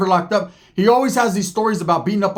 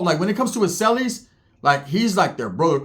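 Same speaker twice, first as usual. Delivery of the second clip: normal talking voice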